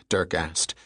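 usual speaking voice

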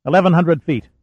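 calling the depth out loudly. A narrator's reading. Navigator calling depth to the skipper, loud. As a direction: sing out the number across the room. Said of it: "Stanley said loudly".

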